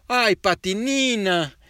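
drawn-out dismayed exclamation; papá exclama. A narrator's reading. (papá exclama despacio, más agudo y fuerte)

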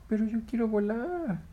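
high, whiny monkey plea; pleading monkey. Acted. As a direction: beg to fly.

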